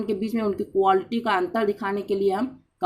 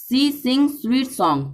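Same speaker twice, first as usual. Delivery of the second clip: slow example read; 3 semitones higher, 3 dB louder, much slower than usual. Shown as a demonstration. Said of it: "देखिए example आपके सामने है".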